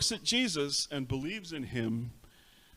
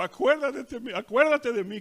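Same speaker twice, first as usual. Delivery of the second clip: high, urgent plea; pleading thief; 10 semitones higher, 5 dB louder than usual. Acted. Dying thief begs Christ to remember him, pleading high. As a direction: beg to be remembered, voice pitched up. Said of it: "simplemente dijo".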